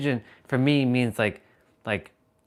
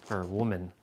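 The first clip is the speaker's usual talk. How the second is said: usual speaking voice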